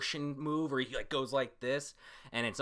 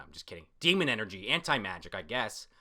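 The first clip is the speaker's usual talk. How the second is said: normal talking voice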